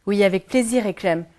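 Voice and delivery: normal talking voice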